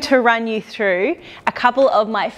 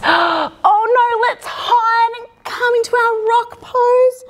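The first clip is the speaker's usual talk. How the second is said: higher, hammed-up gasp and panic; mock fright; 9 semitones higher than usual. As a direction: pretend to be scared for the kids. Pretend panic at cobra.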